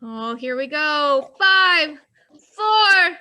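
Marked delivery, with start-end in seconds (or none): 1.4-3.2 excited countdown. Real, not acted. Calling the final countdown.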